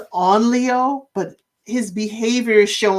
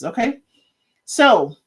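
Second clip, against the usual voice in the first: usual speaking voice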